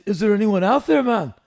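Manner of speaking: lightly voiced [name] asking about the crowd; imitating [name]. Imitating [name]'s question.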